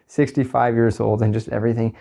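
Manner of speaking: ordinary delivery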